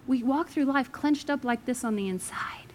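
soft whispered line. Real, not acted. Preacher whispering the point to the congregation. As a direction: lean in and whisper it. Sermon point made intimate.

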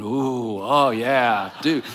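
lightly voiced onlookers, low drawn-out ooh; mock-impressed crowd reaction, pitched low. Imagined crowd hearing his zingers, mock awe. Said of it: "there's a crowd listening going".

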